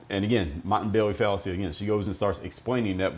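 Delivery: ordinary delivery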